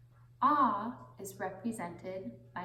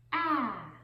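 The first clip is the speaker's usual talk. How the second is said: ordinary talk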